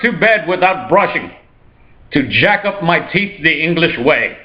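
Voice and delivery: high-pitched, hammed-up English accent; horrible English accent. Rich Hyde Park resident ordering in the police, bad English accent. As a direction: badly fake a fussy English accent. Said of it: "I'm sorry, my accent is horrible".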